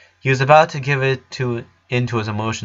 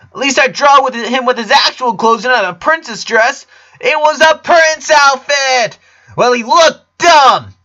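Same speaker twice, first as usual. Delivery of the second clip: much higher, louder bratty yelling; furious little girl through clenched teeth; angry shouting girl; 8 dB louder than usual. A narrator's reading. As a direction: scream at your twin sister, slowing at the end. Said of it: "Lola glared at her twin sister with her teeth clenched".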